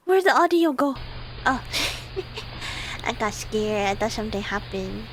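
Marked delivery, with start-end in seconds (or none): none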